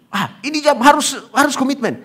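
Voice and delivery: high, loud insistence on the rule; high, firm wife voice telling him to commit; insisting wife. Retold or imitated.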